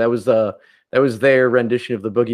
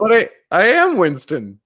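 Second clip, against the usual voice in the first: indignant actor; 7 semitones higher, 3 dB louder than usual. Retold or imitated. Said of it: "he's like".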